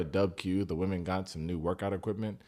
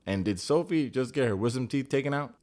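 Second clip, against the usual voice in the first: ordinary talk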